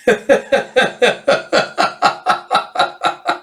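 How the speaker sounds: breathy real laughter at the page. Real, not acted. Reader laughs at the panel.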